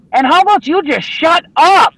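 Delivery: high, loud mock yell at the ranting preacher; retold shout. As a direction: blurt it out like you've finally had enough.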